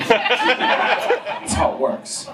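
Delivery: usual speaking voice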